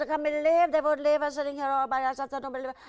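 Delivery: high, loud mock-indignant; indignant outcry. [name] complaining about being left behind, loud and indignant. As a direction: complain in disbelief. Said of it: "I said".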